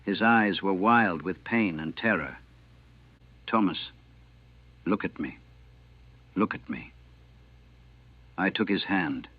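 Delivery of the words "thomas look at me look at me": much softer and lower, steady and close; soft urgent calming. Acted. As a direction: hold a dying boy's attention gently. Chaplain calming dying soldier.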